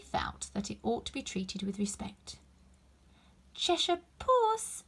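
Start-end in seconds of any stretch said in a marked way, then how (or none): 3.5-4.9 high, slow, timid address; tentatively, unsure of the reply; a shy little girl's careful greeting; timidly. A narrator's reading. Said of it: "she began rather timidly".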